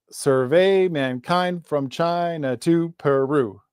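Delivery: slow "survey mankind from China to Peru," every second syllable stressed; "survey mankind from China to Peru" with exaggerated weak-strong rhythm; iambic stress. Shown as a demonstration. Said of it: "iambic pentameter".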